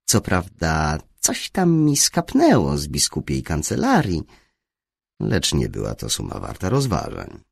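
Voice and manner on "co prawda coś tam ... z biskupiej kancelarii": usual speaking voice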